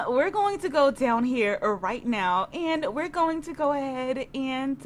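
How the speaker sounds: ordinary delivery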